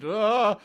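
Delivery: high, loud frightened cry; short terrified "Ah"; scared yelp. Retold or imitated. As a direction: yelp like a scared old man. Voicing scared old man.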